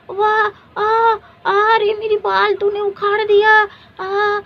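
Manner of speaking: loud, high cries of pain as hair is pulled. Acted. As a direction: yelp in pain. Hair being pulled, crying out.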